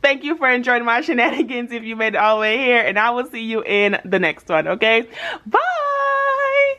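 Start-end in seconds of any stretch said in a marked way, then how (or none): 5.5-6.7 high bright sign-off call; bubbly farewell to viewers; cheery bye. Real, not acted. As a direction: sing out the goodbye. Sign-off of the video.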